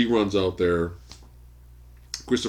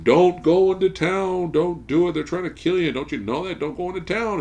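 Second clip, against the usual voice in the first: pleading family; 10 semitones higher than usual. Retold or imitated. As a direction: beg him not to go, voice lifted. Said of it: "the family's begging him".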